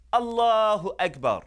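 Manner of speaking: high, loud cry of praise; exultant exclamation. Acted. Greeting a teenager stepping inside the masjid, exultant exclamation. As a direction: proclaim it in wonder.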